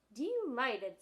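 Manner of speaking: prissy gentleman lion; mincing lion. Acted. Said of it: "in a rather mincing voice".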